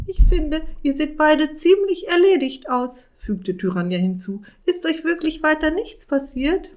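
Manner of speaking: high, loud fake worry; mock-concerned witch. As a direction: sound concerned but don't mean it.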